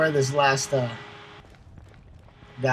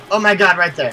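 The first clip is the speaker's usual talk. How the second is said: panicking gamer; 3 semitones higher, 9 dB louder than usual. Real, not acted. Taking fire.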